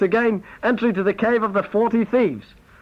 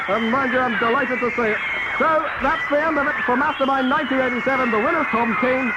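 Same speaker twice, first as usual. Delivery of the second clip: higher, brisk presenter voice over chaos; TV host sign-off; 4 semitones higher than usual. Acted. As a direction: announce the close like a flustered quiz host. TV host signing off amid chaos.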